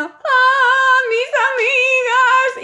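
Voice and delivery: high, loud, slow cry; mock wail. Left at the bus door, high loud mock wail for friends. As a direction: wail after the bus, drawn out.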